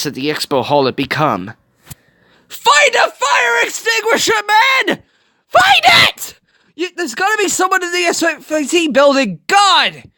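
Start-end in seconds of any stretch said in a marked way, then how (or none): 2.5-10.0 much higher, frantic shouting; yelling at the screen. Real, not acted. Viewer screams at Tadashi to find an extinguisher, frantic shout. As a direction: scream instructions at a character.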